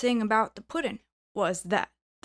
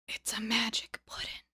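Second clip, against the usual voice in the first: whispered, breath only; low secret whisper; 6 dB quieter than usual. A narrator's reading. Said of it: "said in a low voice".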